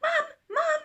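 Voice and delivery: put-on high, loud child calling out. A narrator's reading. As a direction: call up the stairs like an eager little girl. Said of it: "Daisy called".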